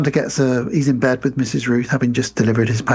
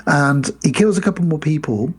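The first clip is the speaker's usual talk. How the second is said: ordinary delivery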